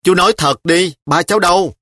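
put-on a child pressing her uncle for the truth. A narrator's reading. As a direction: demand an answer like a child. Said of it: "nó đã hỏi dồn".

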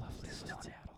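hushed ASMR whisper; barely voiced, close to the mic. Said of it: "Are you gonna say it in A S M R".

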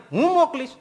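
high, loud stern pronouncement; stern declaration. Retold or imitated. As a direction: declare it like a stern master setting a punishment. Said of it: "મહારાજ કે".

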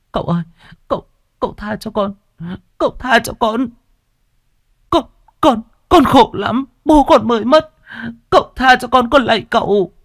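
high, wailing plea for mercy; girl screaming for mercy; desperate pleading. A narrator's reading. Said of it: "Duyên la hét thảm thiết".

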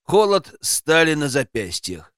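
ordinary delivery, nothing marked

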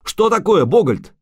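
loud, very high, insistent cry; shouted question. A narrator's reading. Bard demanding news from the hunter, shouting. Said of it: "крикнул Лютик".

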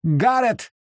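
high, ringing call of a name. Acted. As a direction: call him from afar. Someone calls out Garrett.

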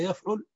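يفعل said yaf'ul; يفعل as yaf-'UL, u in the last syllable; damma on the ayn. Shown as a demonstration. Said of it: "وعين المضارع مضمومة".

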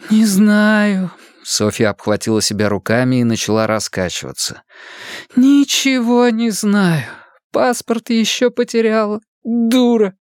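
put-on rock and despair like a lost girl; lightly voiced miserable young woman. Sofia rocking, despairing.

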